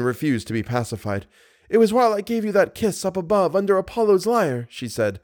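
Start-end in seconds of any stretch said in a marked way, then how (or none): 1.7-4.7 put-on high, agitated young woman's voice; distraught heroine. A narrator's reading. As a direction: lament in a high voice, refusing comfort. Christine refuses to be pacified.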